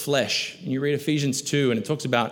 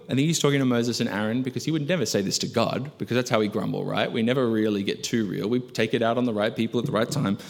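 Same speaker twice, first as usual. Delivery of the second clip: ordinary delivery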